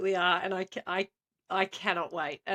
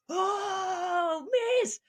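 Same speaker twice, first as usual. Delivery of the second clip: high, drawn-out student wail; whining pupils; 10 semitones higher than usual.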